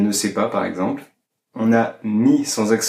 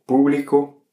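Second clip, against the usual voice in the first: público with the first syllable PÚ punched higher; stress on first syllable; 5 semitones higher than usual. Shown as a demonstration. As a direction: lean on PÚ in público, letting -blico fall away. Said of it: "avec un accent sur le U".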